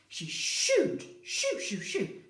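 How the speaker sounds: shooing animals out of the house. Acted. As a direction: shoo the animals out.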